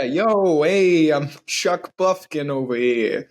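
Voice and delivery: drawn-out, put-on wise-guy greeting; Chuck Buffkin. Pretending to be someone else to approach people, character voice. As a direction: play a slow, swaggering character introducing himself. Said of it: "pretend to be someone else".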